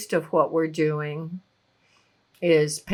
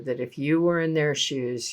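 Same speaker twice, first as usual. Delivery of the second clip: usual speaking voice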